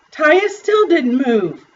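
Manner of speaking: usual speaking voice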